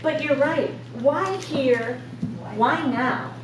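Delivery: ordinary talk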